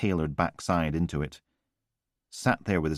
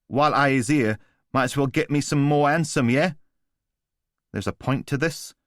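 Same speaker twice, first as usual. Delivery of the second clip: higher, taunting chav with a sneer; cocky street thug; 6 semitones higher, 4 dB louder than usual. A narrator's reading. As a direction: needle him like a smug geezer. Voice of Newman the thug.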